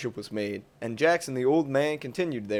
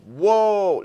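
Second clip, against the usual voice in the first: higher, louder, stunned whoa; amazed exclaim; 7 semitones higher, 7 dB louder than usual. Acted. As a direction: blurt it out, jaw dropped. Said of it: "he exclaimed".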